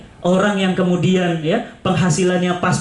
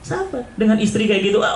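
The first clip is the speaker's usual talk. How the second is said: normal talking voice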